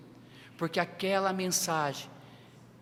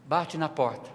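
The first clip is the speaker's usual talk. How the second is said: ordinary delivery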